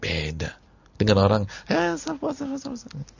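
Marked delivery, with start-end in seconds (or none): 1.7-3.1 high squeaky mock voice; mocking scold. Preacher mimicking people who scold the emotional faster, high and mocking. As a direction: caricature a nagging critic.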